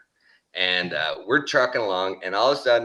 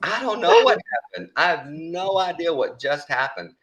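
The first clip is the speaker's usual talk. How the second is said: dazed disbelief; 8 semitones higher than usual. Retold or imitated. Retells his own baffled answer.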